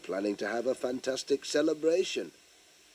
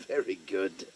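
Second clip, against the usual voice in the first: ordinary delivery, nothing marked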